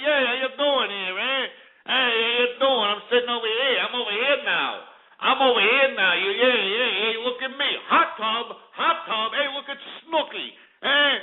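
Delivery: mock the reality show bros; Jersey Shore guy. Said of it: "meatball-eating greaseballs getting on MTV saying".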